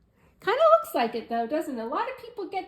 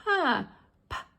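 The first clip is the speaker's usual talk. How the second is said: ordinary talk, nothing marked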